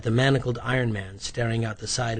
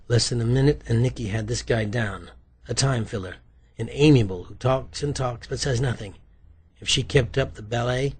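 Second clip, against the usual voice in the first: plain reading voice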